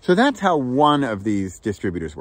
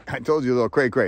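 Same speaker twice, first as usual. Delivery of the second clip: usual speaking voice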